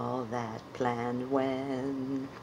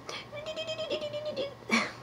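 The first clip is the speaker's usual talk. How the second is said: very high squeaky laugh; high puppet giggle; 6 dB quieter than usual. Acted. Puppet trying its laugh again, a squeaky high giggle. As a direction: giggle way up in falsetto. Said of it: "That's right".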